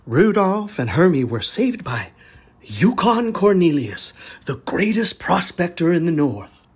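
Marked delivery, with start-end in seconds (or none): none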